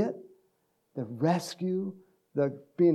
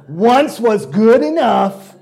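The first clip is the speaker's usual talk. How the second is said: emphatic; 7 semitones higher, 13 dB louder, slower than usual. Real, not acted. Emphatic punchline.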